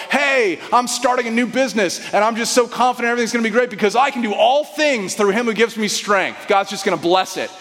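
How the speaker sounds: cheerleader-style overconfidence; hammed-up go-getter pep; peppy inspirational. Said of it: "it's kind of a peppy, inspirational".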